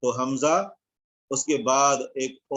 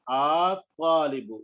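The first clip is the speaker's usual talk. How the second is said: drawn-out, very slow الطالب; slow model reading; much slower than usual. Shown as a demonstration. Teacher modelling الطالب slowly for the class. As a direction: stretch الطالب out syllable by syllable. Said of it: "number پانچ کو ہم پڑھیں گے".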